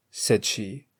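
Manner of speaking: plain reading voice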